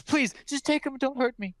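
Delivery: high, pleading and frightened; fearful plea. Acted. Frightened mother begging the stranger to take her baby. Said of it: "she looks a bit feared".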